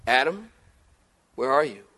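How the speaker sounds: soft, low tender call; gentle searching call. Shown as a demonstration. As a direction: call him like a worried father. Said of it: "Tone's everything".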